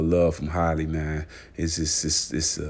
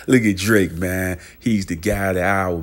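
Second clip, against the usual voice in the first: louder, hyped call to look; excited hype; 7 dB louder than usual. Pretend. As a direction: sell the moment to the crowd. Hyping [name]'s reaction.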